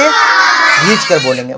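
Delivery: खींच stretched long and low; slow, low teaching demo of खींच; drawn out. Shown as a demonstration. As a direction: draw out the "ee" in खींच. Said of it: "थोड़ा खींच कर बोलेंगे".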